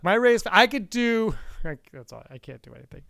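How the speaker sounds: ordinary delivery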